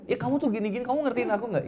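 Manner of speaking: high, loud mock nagging; acted marital outburst; exasperated wife. Acted wife challenging her husband.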